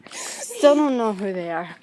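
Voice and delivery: normal talking voice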